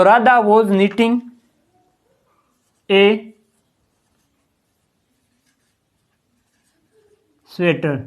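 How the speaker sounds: first slow dictation of Radha was knitting a sweater, each word spaced. Shown as a demonstration. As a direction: dictate it slowly for students to write. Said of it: "dictating the English sentence". (धीमे-धीमे लिखवाते हुए)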